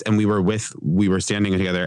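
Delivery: usual speaking voice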